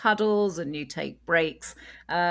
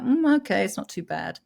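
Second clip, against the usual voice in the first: higher, quick mock baby voice, going from content to fussy; baby's inner voice; 7 semitones higher, faster than usual. Mother voicing a settling baby's protest, mock baby voice. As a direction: play a baby who changes its mind and wants picking up. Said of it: "crying as a baby is like".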